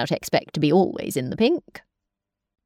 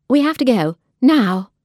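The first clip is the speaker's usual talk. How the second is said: louder, punching "now"; urgent order; 4 semitones higher, 5 dB louder than usual. Acted. Nurse bursting in to hurry them to the ships, loud order.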